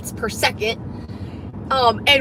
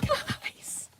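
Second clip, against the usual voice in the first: whispering; 12 dB quieter than usual. Real, not acted. Sudden whisper after key panic.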